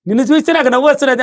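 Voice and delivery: husband tells his wife she makes him laugh; husband amused. Acted. Husband voiced replying.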